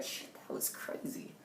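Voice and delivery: slow, voiceless mock shock; stunned whisper. Teen voicing anyone in their shoes, stunned whisper.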